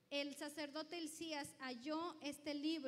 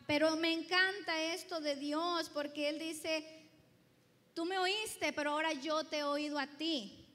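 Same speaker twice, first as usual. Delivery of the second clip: louder, fervent sermon delivery; full-force preaching; 18 dB louder than usual. Acted. Pastor exhorting his congregation, full-force preaching. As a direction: preach it out to the back row.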